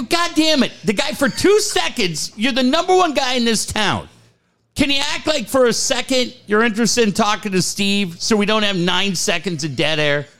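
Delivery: high, pressing rant; exasperated rant. Retold or imitated. Screener scolding Ted over dead air, high exasperated rant. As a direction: scold the host in a rush. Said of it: "I go".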